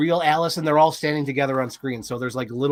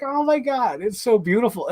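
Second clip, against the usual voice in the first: gushing over it; 8 semitones higher than usual. Retold or imitated. Retelling own moved reaction.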